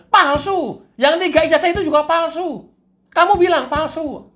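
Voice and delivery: high, loud cartoon devil. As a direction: push it up high and insist. Devil confirming loudly.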